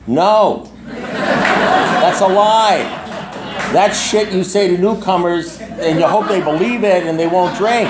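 high, laughing through the words. Real, not acted. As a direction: laugh it off as a lie. Laughing at the AA saying.

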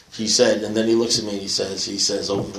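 ordinary talk, nothing marked

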